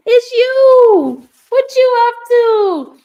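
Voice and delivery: very high, loud, drawn-out squeal; excited greeting. Real, not acted. Streamer greeting a friend in chat, excited greeting. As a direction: sing out a thrilled hello, stretching the last word. Greets a known viewer she met.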